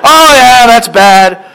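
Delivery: a hammed-up friend groaning; sympathetic friend. Friend reacting to bad news, hammed-up high dismay. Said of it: "friend voice in the joke".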